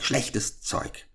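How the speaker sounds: whispered, scornful witch's mutter under the breath. A narrator's reading. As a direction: hiss schlechtes Zeug to yourself. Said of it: "murmelte sie". (geflüstertes, verächtliches Murmeln der Hexe)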